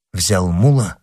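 plain reading voice

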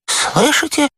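high, voiced frightened moan; high-pitched terrified question; moaning in fear. A narrator's reading. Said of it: "простонал он".

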